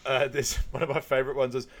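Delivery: usual speaking voice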